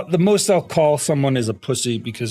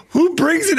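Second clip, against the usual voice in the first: much higher, louder disbelief; incredulous outburst; 4 dB louder than usual. Retold or imitated. Streamer retelling seeing an elephant, high incredulous yelp. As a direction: yelp it like you can't believe it. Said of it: "I was like".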